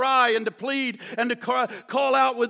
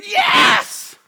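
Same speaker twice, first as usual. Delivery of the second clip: very high, loud victory yell; acted burst of joy; elated shout; 12 semitones higher, 7 dB louder than usual. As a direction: shout it like you just won a car. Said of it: "I bet you'd say".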